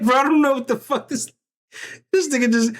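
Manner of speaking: high-pitched, panicky mock inner voice of a spooked onlooker; terrified bystander. The onlooker Chicken stuck beside the screaming man, high, mock-panicked. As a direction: play the scared guy in the background, voice jumping up high.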